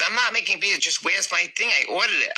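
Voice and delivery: imitating a huffy mother, high-pitched; high, put-upon mom protesting; indignant protest. Mother protests in retold quarrel.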